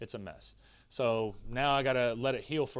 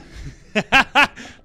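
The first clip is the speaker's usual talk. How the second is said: loud laugh; 11 semitones higher, 12 dB louder than usual. Real, not acted. Laughs at chat comment.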